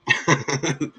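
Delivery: high burst of real giggling; short laugh. Real, not acted. Streamer tickled by chat's teasing, laughing. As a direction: break into a quick laugh.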